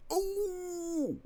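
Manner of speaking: long, high falsetto "ooh"; high-pitched "ooh". Real, not acted. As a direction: hold a very high "ooh", dropping at the end. Howls while starting the stream.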